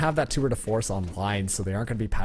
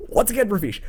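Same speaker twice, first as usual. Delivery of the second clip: high and loud call; excited; 10 semitones higher, 5 dB louder than usual. Real, not acted. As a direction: hype it up to the co-caster. Caster's post-match hype.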